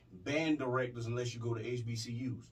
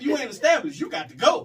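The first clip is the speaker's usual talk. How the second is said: loud, stern ultimatum; shouting; 8 semitones higher, 8 dB louder than usual. Retold or imitated. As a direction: lay down the law at full volume. Telling son to move out.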